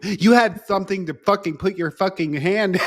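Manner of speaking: hamming up her outburst; mock angry outburst. Retelling Andrea snapping at Brandon, mock angry.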